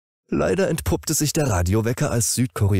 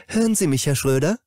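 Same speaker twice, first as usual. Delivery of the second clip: much higher, kind caring nurse; 9 semitones higher than usual. Acted. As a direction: gently call him awake as the nurse. Nurse wakes the patient.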